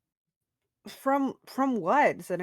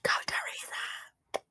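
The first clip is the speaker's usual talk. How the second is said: slow, unvoiced whisper; a slow, whispered cheer from the wings; 10 dB quieter, much slower than usual. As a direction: whisper it encouragingly. Cheering her on under breath.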